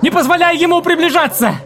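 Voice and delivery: very high, loud shriek. Acted. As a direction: wail it out in desperation. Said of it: "завопила Джессика".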